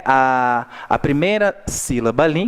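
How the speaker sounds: normal talking voice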